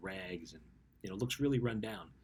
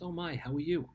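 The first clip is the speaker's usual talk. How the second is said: bright, much higher delighted hello; excited greeting; 9 semitones higher, 6 dB louder than usual. Retold or imitated. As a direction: greet an old friend with surprise and joy. Owner greets returning philosopher warmly.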